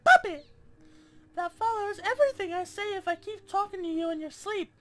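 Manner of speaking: put-on very high-pitched girly voice. Gamer reading her note aloud, put-on girly voice. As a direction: read it in a squeaky-high put-on girl's voice. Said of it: "Should I be reading this in a girly voice?".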